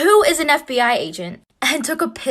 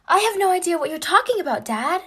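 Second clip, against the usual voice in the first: high-pitched play-dumb denial; mock-innocent protest; feigned innocence; 8 semitones higher than usual. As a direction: act clueless, pitched up. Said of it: "Pretending like I don't know what's going on".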